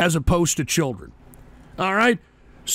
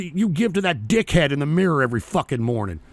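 normal talking voice

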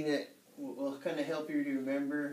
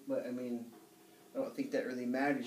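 usual speaking voice

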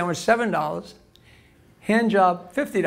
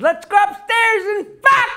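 put-on higher, louder old-lady voice; old wife's proposal; 11 semitones higher, 4 dB louder than usual. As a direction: chirp it like an eager old wife.

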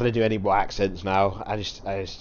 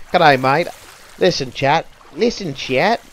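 loud, high put-on Australian accent; hammed-up Australian, calling to the chat; attempted Australian accent; 6 semitones higher, 8 dB louder, slower than usual. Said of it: "I can't do an Australian accent".

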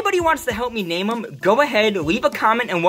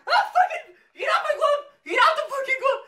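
very high, shrieking panic; panicked screams. Real, not acted. To the scorpion on his glove, panicked shrieking.

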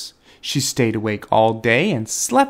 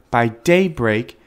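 plain reading voice

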